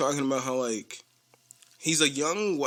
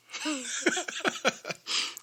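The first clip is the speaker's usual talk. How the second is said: laugh; 13 semitones higher, 3 dB quieter than usual. Real, not acted. Laughing retelling story.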